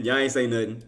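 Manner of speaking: normal talking voice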